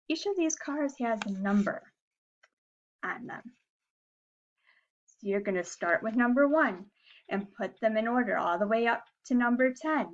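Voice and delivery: usual speaking voice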